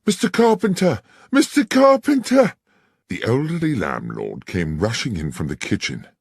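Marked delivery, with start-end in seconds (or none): 0.0-2.5 frantic scream, much higher and louder; a desperate young woman screaming for help. Acted. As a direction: scream his name in panic. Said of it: "came screaming into the bar".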